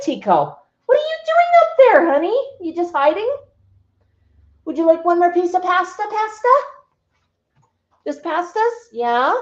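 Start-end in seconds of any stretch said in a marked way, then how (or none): 0.9-8.7 much higher, louder coo to the bird. Real, not acted. Owner offering her cockatoo pasta, cooing baby talk.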